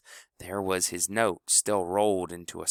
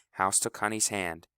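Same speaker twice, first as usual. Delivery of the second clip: plain reading voice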